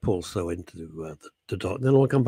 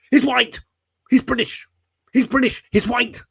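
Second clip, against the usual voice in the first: much higher and louder, rushed mock announcement; mock police statement; 6 dB louder than usual. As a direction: blurt it out like eager police. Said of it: "couldn't say quick enough".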